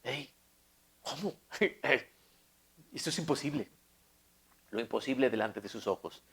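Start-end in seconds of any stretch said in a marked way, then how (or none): 0.0-3.7 stunned disbelief. Retold or imitated. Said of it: "Puedes imaginarte los ojos del rey".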